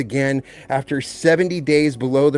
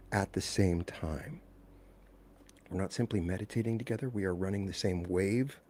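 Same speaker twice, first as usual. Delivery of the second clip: low, very soft, hushed meditation voice; guided meditation; 9 semitones lower, 16 dB quieter than usual. Real, not acted. Leader settling a worldwide group into breathing together, guided meditation. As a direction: guide them gently into stillness, with long pauses.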